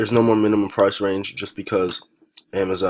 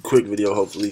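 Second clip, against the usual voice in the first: normal talking voice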